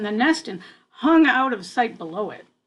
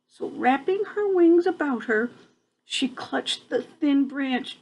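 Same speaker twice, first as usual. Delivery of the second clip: usual speaking voice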